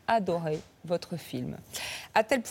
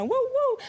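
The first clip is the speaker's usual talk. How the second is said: dog bark. Acted. Imitating a little dog.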